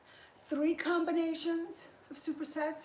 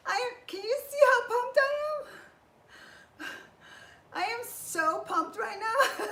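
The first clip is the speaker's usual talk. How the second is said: high, loud laughing excitement; giddy post-workout laughter; pumped laughing; 8 semitones higher, 5 dB louder than usual. Real, not acted. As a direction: laugh through the thrill.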